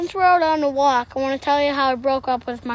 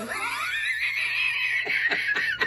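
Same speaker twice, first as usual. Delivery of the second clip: high laughter after a joke; real laugh; 7 dB quieter than usual. Real, not acted. Teller finishes the diarrhea joke, laughing. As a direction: laugh at your own punchline.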